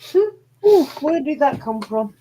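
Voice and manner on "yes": yawning. Real, not acted. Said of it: "What, the yawn?".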